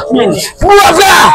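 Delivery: battle cries. Acted. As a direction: yell with each blow. Fight cries.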